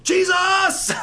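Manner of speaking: loud, high, desperate scream; cry for help. Retold or imitated. Mother calling out as the car crashes, panicked scream. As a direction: shriek the name in sudden panic. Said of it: "It was a cry for help".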